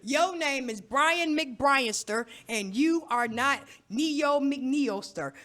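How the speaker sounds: slow, loud, every word hammered; firm slow scolding. Acted. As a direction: lay down the law word by word. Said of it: "I said it like that".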